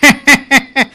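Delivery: short burst of laughter. Real, not acted. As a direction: laugh out at the punchline. Laughing at the joke.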